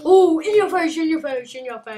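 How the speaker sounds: loud, high taunt that fades by the end; triumphant gamer taunt, dying down; gloating taunt. Real, not acted. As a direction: rub it in their face, then let it trail off. Gloating over a kill.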